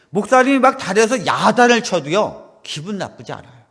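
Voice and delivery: usual speaking voice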